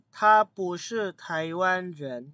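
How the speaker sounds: very slow, careful Mandarin negation; slow model sentence. Shown as a demonstration. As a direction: say it slowly for students. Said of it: "Artinya apa". (dibaca sangat pelan)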